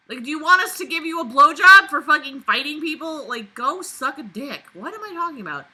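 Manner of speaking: mock the narrator loudly; heated sarcastic rant. Real, not acted.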